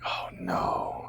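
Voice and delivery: low, hushed inner dread; dismayed whisper. Retold or imitated. Actor realizing he blew the take, whispering. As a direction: whisper it as the stomach drops. Voicing dread after failing.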